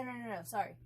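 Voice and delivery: ordinary talk, nothing marked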